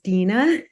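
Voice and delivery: Dina said as DEE-nah; correct name pronunciation. Shown as a demonstration. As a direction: say Dina with a long "ee" in the first syllable. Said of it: "my first name is pronounced".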